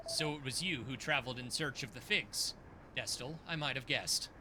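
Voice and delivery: low, solemn teacher voice; stern old mentor. Acted. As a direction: speak as a grave celestial master.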